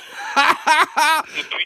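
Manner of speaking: high-pitched burst of laughter. Real, not acted. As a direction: crack up at the caller. Laughing at the caller. (fou rire aigu)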